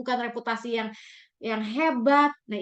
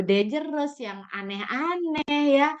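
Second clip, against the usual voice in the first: usual speaking voice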